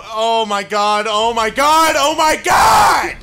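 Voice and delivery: rising from high talk to a loud shout; building alarm. Real, not acted. Watching boss's delayed attack.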